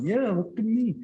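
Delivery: high, smug and preening; suave showoff voice. Retold or imitated. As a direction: show off, pleased with yourself. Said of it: "He's like".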